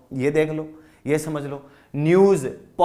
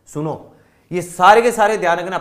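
normal talking voice

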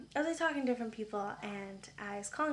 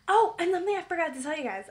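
excited; 6 semitones higher, 8 dB louder, faster than usual. Real, not acted. Sudden remembered news to viewers.

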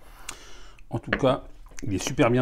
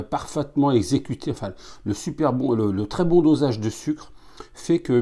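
speaking between small sips; glass held near mouth; talking with glass at lips; 3 semitones higher, 4 dB louder than usual. Real, not acted. Seen 2: Small glass held at lips and nose throughout the words.

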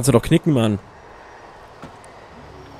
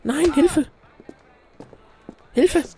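very high squeaky cry for help; mock panic; much slower than usual. Gamer losing a bar brawl, high mock-panicked squeal. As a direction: squeal for help in play terror.

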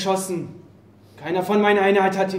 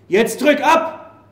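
higher, slow cry to shoot; desperate demand; 6 semitones higher, 4 dB louder, slower than usual. Acted. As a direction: dare her to pull the trigger. Soldier demands she shoot.

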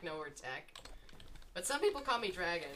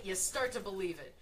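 normal talking voice

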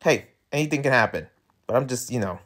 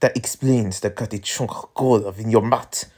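French character accent. Shown as a demonstration. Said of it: "wrong accent".

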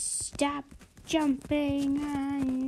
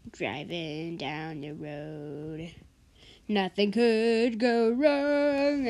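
slow dramatic; 3 semitones lower, slower than usual. Acted. Dramatic slow narration of drive.

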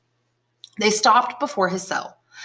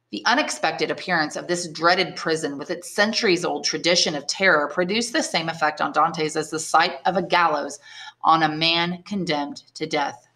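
ordinary delivery

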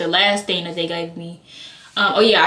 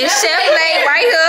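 laughing shout-out; 8 semitones higher, 5 dB louder than usual. Real, not acted.

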